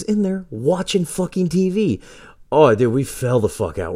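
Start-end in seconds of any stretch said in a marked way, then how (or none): none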